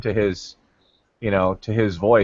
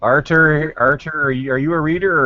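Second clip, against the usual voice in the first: raised, higher call for attention; 6 semitones higher than usual. Real, not acted. Host hails a silent co-host, calling out. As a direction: call the name to rouse someone.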